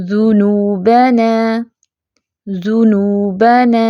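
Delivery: اخذنا recited very slowly, heavy خ, light ذ held still; slow, careful recitation of each word; tajweed demo. Shown as a demonstration. Teacher recites examples slowly for students. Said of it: "کیسے پڑھیں گے؟".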